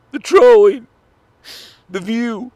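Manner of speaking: high, loud, hammed-up blubbering; mock crying. Mourning a dead hater on camera, hammed-up mock sobbing. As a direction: wail it out like fake sobbing grief. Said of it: "These aren't crocodile tears".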